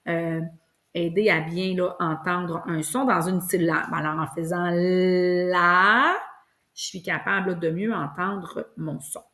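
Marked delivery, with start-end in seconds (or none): 4.7-6.2 "la" with long L and long A, rising and swelling; stretched syllable. Shown as a demonstration. Teacher helping to hear a hidden sound, syllable drawn out. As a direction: stretch "la" like an elastic. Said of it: "le truc de l'élastique".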